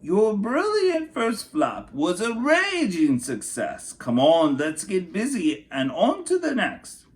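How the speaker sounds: high, bright-voiced encouraging aunt; cheery aunt. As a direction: cheer her on like a proud aunt. Aunt cheers Rosie in story.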